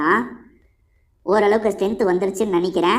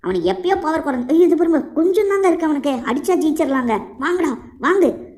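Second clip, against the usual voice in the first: excited; 7 semitones higher than usual. Real, not acted. Close to winning the fight.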